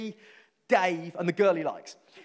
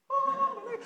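mock shock. Shocked at being invited to church.

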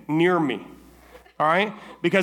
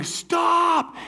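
screaming stop. Retold or imitated. Leg being pulled apart, screaming at the crew to stop. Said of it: "I start screaming".

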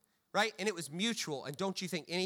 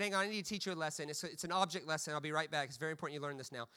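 fast, softer businesslike instruction; brisk dad lecture; 6 semitones lower, 7 dB quieter, faster than usual. Retold or imitated. Dad rushing off to fetch an object lesson, fast and brisk. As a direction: rattle it off like a dad with a plan. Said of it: "He said".

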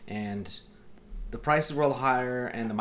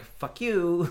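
high, drawn-out mock outrage; indignant retort; 7 semitones higher, 3 dB louder than usual. Buyer answering the company's email, indignant. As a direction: throw it back at the email, pitched up.

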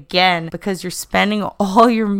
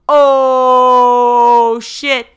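mock exclaim; 5 semitones higher, 8 dB louder than usual. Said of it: "in character yelled".

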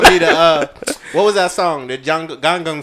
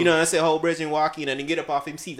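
usual speaking voice

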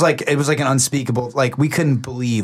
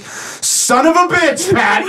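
exasperated curse; 3 dB louder, much slower than usual. Retold or imitated. Lawyer realizes who is calling.

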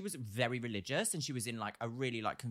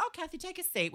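higher, sweet welcome; sugary sales voice. Retold or imitated. Makeup artist warmly seating Kathy. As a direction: invite her in sweetly. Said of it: "I was like".

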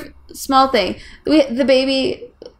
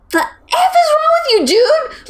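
outraged yell. Retold or imitated. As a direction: scream it at the TV. Cursing at the TV.

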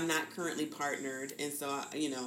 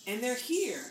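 bright announcement; 8 semitones higher, 5 dB louder than usual. Retold or imitated. Imitating moment of birth.

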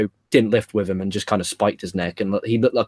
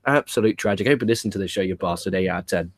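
usual speaking voice

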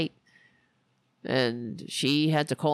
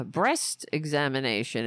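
normal talking voice

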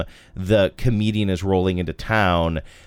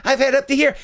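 much higher voice, comic Leno whine; Jay Leno impression; 4 dB louder, faster than usual. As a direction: play an exasperated old comic. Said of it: "I really like your Leno".

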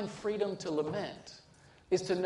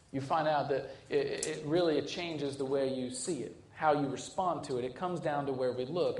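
ordinary delivery

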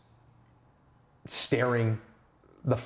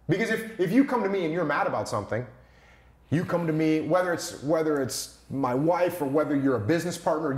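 ordinary delivery

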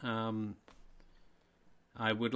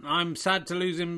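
usual speaking voice

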